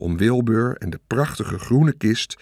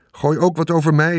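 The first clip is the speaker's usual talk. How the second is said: put-on high, raised boyish plea; boy calling out; 6 semitones higher, 4 dB louder than usual. A narrator's reading. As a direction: call out like a kid wanting a turn. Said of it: "riep Michiel". (roepend, vragend jongetje)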